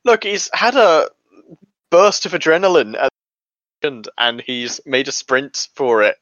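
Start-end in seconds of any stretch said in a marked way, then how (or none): 0.0-4.1 defensive. Acted. Defending the story in character.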